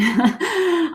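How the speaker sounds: short high giggle; light laugh. Real, not acted. Reader amused at going all in on romance, light giggle. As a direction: chuckle to yourself briefly.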